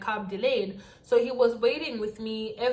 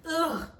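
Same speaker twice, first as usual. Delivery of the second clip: short higher-pitched groan of disgust; disgusted ugh; 6 semitones higher than usual. Acted. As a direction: recoil with an "ugh". Disgust at his underwear.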